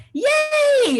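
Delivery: high-pitched, drawn-out cheer; super excited. Retold or imitated. Recalling hearing who the guest speaker is, super excited. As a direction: squeal it out with delight, stretched long. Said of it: "I was super excited".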